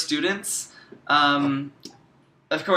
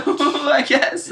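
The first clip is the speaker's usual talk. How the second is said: laughing; 10 semitones higher, 4 dB louder than usual. Real, not acted. Laughing at own retold reply.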